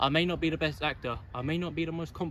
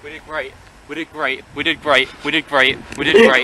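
from plain to loud yell; building to a shout; 13 dB louder than usual. Real, not acted. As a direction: get louder each time. Repeated, building.

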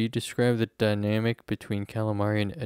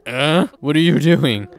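raised voice, confused mock reply; playing the sim, puzzled and louder; mock baffled sim; 8 dB louder than usual. Voicing the sim who shrugs off her flirting, puzzled. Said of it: "he's just like".

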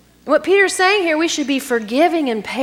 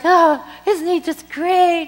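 high, slow, dreamy gushing over her fiancé; mock-starry-eyed young woman; swooning girl; 9 semitones higher, 4 dB louder, slower than usual. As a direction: gush like a lovestruck bride-to-be. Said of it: "And she's like".

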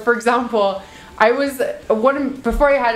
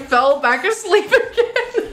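laughing; 8 semitones higher, slower than usual. Real, not acted. Laughing at herself.

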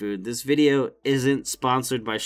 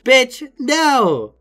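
loud high burst of refusal; outraged yell; 9 semitones higher, 6 dB louder than usual. Real, not acted. Fed-up worker yelling back at an entitled customer, loud.

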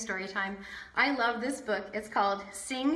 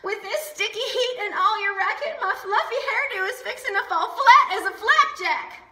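much higher, louder complaint; fussy big-sister voice, much higher and louder; fussy older sister; 9 semitones higher, 6 dB louder than usual. Acted. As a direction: whine about ruined hair. Sister complains about her hairdo.

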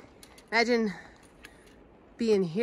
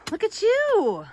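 high doggy praise; 12 semitones higher, 4 dB louder, slower than usual. Real, not acted.

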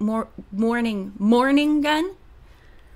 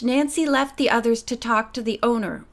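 plain reading voice

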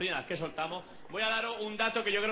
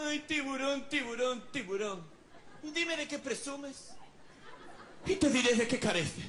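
mock-offended, higher girlish voice; miffed girl; 4 semitones higher, slower than usual. Annoyed girl scolding the comic, miffed. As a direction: needle him in a put-on high voice.

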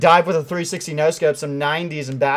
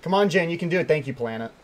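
usual speaking voice

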